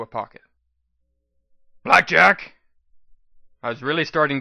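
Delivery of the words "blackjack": loud far-off call; shouting a name. A narrator's reading. Companion calling Blackjack over, loud shout. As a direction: call her name urgently from across the field.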